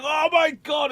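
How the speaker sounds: high, loud cry of amazement; re-enacted "can't believe it" yell; retold excited shout. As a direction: burst out as the friend leaping up, astounded. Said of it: "he literally jumped up".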